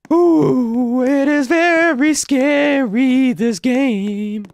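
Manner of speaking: high, drawn-out mock wail; hammed-up falsetto fright, stretched slowly; mock scared. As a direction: wail it like a pretend scaredy-cat. Playing scared at horror game.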